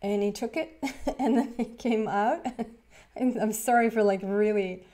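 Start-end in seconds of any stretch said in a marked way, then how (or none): none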